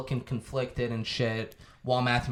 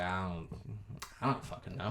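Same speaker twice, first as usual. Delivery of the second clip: defeated mumble; 6 semitones lower, 14 dB quieter, faster than usual. Retold or imitated. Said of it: "He's like".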